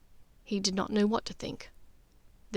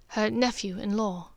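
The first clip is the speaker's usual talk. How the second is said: ordinary delivery, nothing marked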